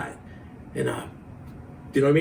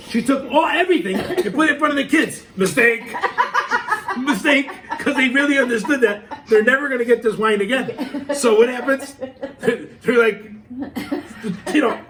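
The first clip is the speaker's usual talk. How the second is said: words bubbling with laughter, higher; laughing while telling; 8 semitones higher, 4 dB louder than usual. Real, not acted. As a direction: crack up through the punchline. Telling funny story, laughing.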